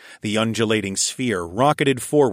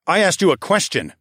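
raised, sneering challenge; higher-pitched scornful demand; 8 semitones higher, 4 dB louder than usual. A narrator's reading. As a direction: sneer it at a cornered enemy. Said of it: "I sneered".

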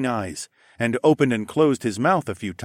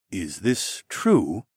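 slow doubtful question; much slower than usual. Acted. Vertis asks captain.

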